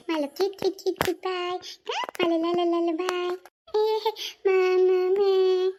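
put-on high, sing-song baby talk to a toddler. Grandmother cooing baby talk to her grandson. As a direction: coo at the little one in baby words.

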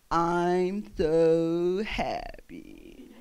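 very slow, low, doleful drone; Droopy the dog. Retold or imitated. Dad mocking the cartoon dog, slow and gloomy. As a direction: drag it out mournfully, mocking happiness. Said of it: "used to mock him".

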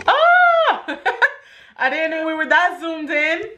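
laughing shriek of shock; startled squeal into laughter; surprised. Real, not acted. Shocked at zoomed-in camera.

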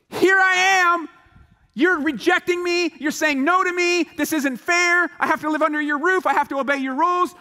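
put-on high, loud indignant teen complaint; fourteen-year-old yelling at her dad about the rules; teen lashing out. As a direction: protest as if it's unfair.

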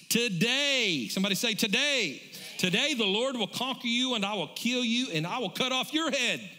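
preacher voicing David's defiance; preached declaration. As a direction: hurl each line like a war cry.

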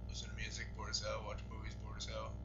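bored refrain. Real, not acted. Repeating refrain, falling.

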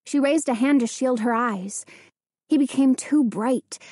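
plain reading voice

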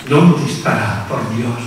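ordinary talk

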